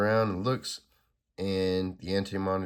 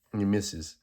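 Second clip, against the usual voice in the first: ordinary delivery, nothing marked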